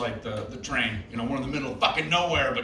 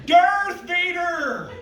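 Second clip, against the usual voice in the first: Jim Carrey; 13 semitones higher, much slower than usual. Pretend. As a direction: stretch out the name in a wild, rubbery Carrey yell. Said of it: "This is Jim Carrey's audition".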